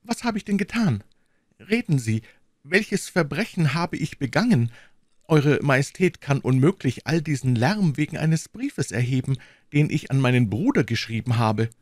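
high, anxious queen's voice; a frightened queen defending herself; a queen begging to know her crime; pleading queen. Acted.

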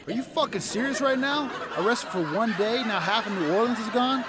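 high, loud outraged complaint; pissed-off God. Retold or imitated. God waking to disaster, high outraged yell. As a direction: rage at the damage done. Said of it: "God's waking up pissed".

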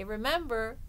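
ordinary delivery

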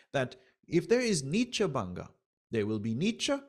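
usual speaking voice

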